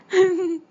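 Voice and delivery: high, loud burst of laughter; helpless high-pitched laugh; real laugh. Real, not acted. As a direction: burst out laughing mid-story. Cracks up while reading.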